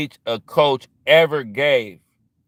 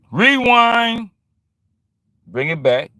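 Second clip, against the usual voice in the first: sudden high, loud DJ shout; high shouted rewind; hype rewind call; 8 semitones higher, 5 dB louder, slower than usual. Acted.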